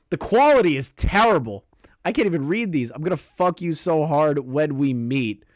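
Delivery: ordinary delivery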